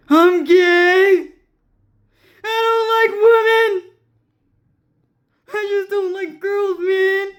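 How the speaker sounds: high, wailing fake sobs; mock crying. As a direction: blubber out a confession in a squeal. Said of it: "They cry like that a lot".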